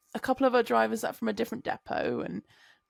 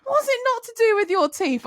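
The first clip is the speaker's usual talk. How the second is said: much higher, louder mock-innocent question; imitating Kat teasing; 12 semitones higher, 7 dB louder than usual. Coworker exposing Chloe's excuse, high teasing. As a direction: ask it sweetly to expose her. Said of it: "She said".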